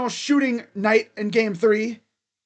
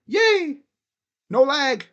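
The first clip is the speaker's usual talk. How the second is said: excited cheer; 5 semitones higher, 5 dB louder, slower than usual. Real, not acted. Celebrating no stream lag.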